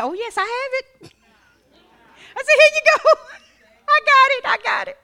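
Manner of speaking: high, laughing excitement; giddy joy. Retold or imitated. Telling the cashier she has the money, high and giddy. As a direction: bubble over with glee. Retelling joy at paying bill.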